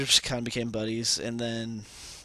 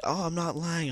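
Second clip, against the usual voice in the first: high-pitched imitation of a girl protesting; girl's protest; 7 semitones higher than usual. Girl insisting to him she means it, high imitated voice. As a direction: raise the pitch to mimic her insisting.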